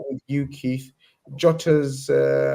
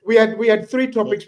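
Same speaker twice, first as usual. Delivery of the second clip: laughing; 9 semitones higher, 5 dB louder than usual. Real, not acted. Laughing, wrapping up.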